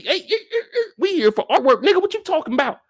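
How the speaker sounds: very high, squawked protest; indignant rebuff. Pretend. Married woman insists they came for artwork, indignant. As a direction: shut him down in hammed-up outrage. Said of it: "this is supposed to be".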